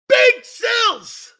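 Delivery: big shouted show opener; hype callout. Real, not acted. Host shouting the show opener to his stream. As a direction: belt the name out like a ring announcer.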